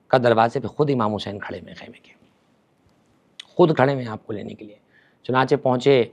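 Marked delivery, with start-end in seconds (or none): 0.2-4.7 low, hushed, reverent whisper; awed whisper. Acted. Son whispering to his father that Imam Husain waits, hushed. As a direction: breathe it out in awe.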